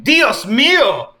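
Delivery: high, loud, slow mock Cajun cry; hammed-up Cajun accent exclamation; thick Cajun accent cry. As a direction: wail it in a hammy Cajun accent. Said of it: "cried Sonic in a thick Cajun accent".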